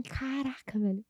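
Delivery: stunned aside to herself; hushed exasperated remark; muttered to herself. Retold or imitated. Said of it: "eu fiz assim".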